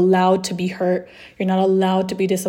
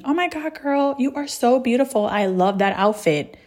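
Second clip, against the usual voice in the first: mock gushing compliment; 4 semitones higher than usual. Somebody complimenting her looks, mock gushing. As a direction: imitate a friend gushing over you.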